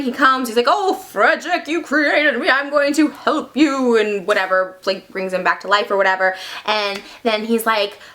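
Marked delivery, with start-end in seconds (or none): 0.7-4.0 slow, deliberate creature speech; monster voice. Acted. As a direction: draw out each phrase like a newly clever monster. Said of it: "the monster has some of his brain".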